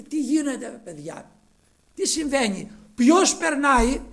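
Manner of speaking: lightly acted blind man calling out questions; urgent questioning. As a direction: ask the passers-by with rising urgency. Blind man asking passers-by what happens.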